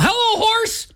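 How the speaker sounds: mock-holler it high without real volume; mock yell. Cyclist calling out to horses as he passes, mock yell. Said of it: "I will always yell".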